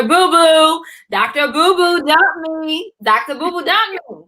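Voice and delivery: milk the breakup for comic drama; mock lament. Recounting being dumped, mock lament.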